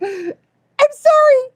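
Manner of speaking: very high, loud, drawn-out wail; retold panicked apology. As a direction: squeal a hammed-up apology. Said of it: "I'm like".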